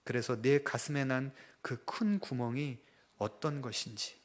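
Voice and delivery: God answering the grumbler, slow and solemn. Retold or imitated. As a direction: speak as God, gently correcting. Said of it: "하나님께서 음성을 들려주시더래요".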